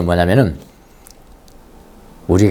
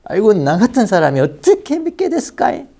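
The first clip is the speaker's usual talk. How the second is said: put-on amazed old voice; 8 semitones higher than usual. Acted quote, marveling at own faith.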